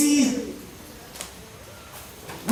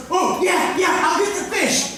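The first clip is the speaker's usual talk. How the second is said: eager; 7 semitones higher than usual. Retold or imitated. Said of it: "Peter goes".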